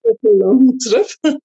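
laughing through the words. Real, not acted. Guest laughing back at host's comment. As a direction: chuckle it out as you echo.

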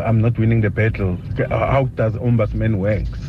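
ordinary talk, nothing marked

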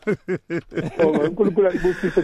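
laughing through the thanks; a warm laugh in the words. Real, not acted. Laughing farewell.

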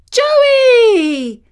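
high, loud shout with a laugh; joyful exclamation. A narrator's reading. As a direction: burst out laughing with relief at finding him. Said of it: "she exclaimed".

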